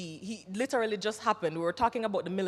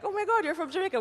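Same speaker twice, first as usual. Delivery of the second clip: high, thrilled gasp of recognition; excited surprise; 12 semitones higher, 4 dB louder, faster than usual. Retold or imitated. Casting people surprised at her accent, high excited exclamation. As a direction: squeal it like you just spotted a Jamaican. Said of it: "And they're like".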